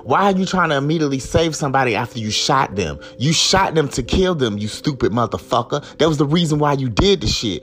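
high-pitched, mounting outrage; real anger. Real, not acted. Furious at an officer who claims to save her, angry. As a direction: confront them, pitch climbing as you go. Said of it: "I'm really just mad as fuck".

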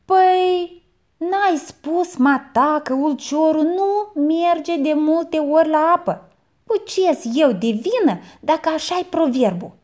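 high, loud mock-innocent reply; boy pleads innocence to his aunt. A narrator's reading. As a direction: play innocent, quoting her proverb. Boy defends himself.

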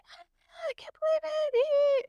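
imitated best friend's very high squeal; mock ecstatic falsetto shriek; so excited. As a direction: squeal it in thrilled disbelief. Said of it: "she was so excited".

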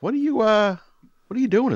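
high, loud put-on voice of a nosy neighbour; mock neighbour. Nosy neighbours outside asking about the noise, high loud mock voice. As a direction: squawk the neighbours' question.

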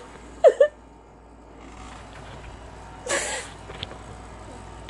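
high, teary voice, sobbing between words; real sobs breaking the sentence; crying. Real, not acted. Upset, breaking down mid-vlog.

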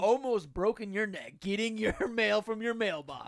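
loud, hyped ad announcer; mock the problem like a TV ad; infomercial voice. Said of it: "They're like".

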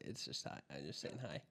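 near-whispered correction; quiet. Real, not acted. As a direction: say it under the breath.

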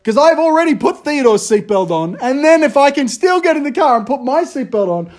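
dad losing patience at the car, winding up to a peak; mock-furious parent escalating; exasperated rant. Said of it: "That's what I say to Gideon".

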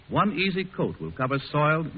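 ordinary delivery

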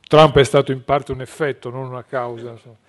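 muffled behind a mask; through a face mask. Real, not acted. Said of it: "conduco la mia battaglia perdente con la mascherina".

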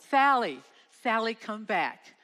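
loud, high, slowing call into the dark; yelling her name. Retold or imitated. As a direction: call out desperately to a lost friend. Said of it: "and we yelled".

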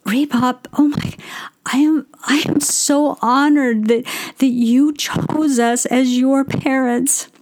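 hushed, moved and honored; awed whisper. Retold or imitated. Mother telling her son she is honored, awed whisper. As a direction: breathe it as if deeply touched, slowing down. And I was stunned.

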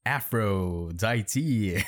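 "Aphrodite" drawn out slowly as Afro-di-tie; pronounced as misspelled. Shown as a demonstration. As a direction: stretch "Aphrodite" syllable by syllable, mocking the spelling. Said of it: "She just spelled Aphrodite as Afro".

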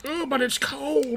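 high, slow put-on whine; mock whining. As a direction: whine softly like a reluctant traveler. Whining imitation of reluctant winter traveler.

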